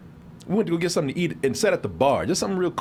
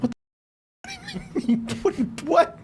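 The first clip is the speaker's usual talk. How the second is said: baffled what; 14 semitones higher than usual. Retold or imitated. Baffled reaction to odd saying.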